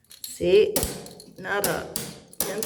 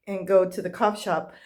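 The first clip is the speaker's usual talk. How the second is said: ordinary talk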